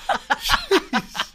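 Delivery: big shouted laugh. Real, not acted. Burst of laughter at teasing.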